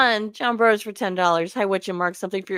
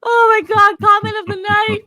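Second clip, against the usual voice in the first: high, loud delighted squeal; excited shriek; 12 semitones higher, 9 dB louder, slower than usual. Real, not acted. Host squealing at a viewer's comment, thrilled.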